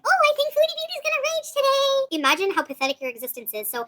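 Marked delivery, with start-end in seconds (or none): 0.0-2.2 very high, squeaky sing-song mimicry; mock them in a childish high-pitched voice; mocking critics. Streamer imitating adults who bait her, mockingly high-pitched. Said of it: "Imagine an adult with children going".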